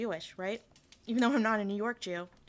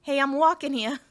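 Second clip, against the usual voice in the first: put-on loud, higher-pitched Staten Island accent; 4 semitones higher, 9 dB louder than usual. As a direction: call it out like a brash Staten Islander. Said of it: "a Staten Island kind of accent".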